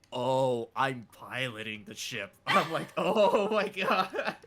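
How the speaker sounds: loud, drawn-out mock alarm; exaggerated dawning shock, voice climbing into laughter; retold screaming. Streamer retelling his first go at piloting, loud alarm. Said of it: "having Sarah levels of screaming".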